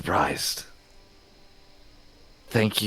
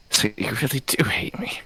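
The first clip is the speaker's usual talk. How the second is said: whisper; 3 semitones higher, 5 dB quieter than usual. Acted. Revived character to his killer.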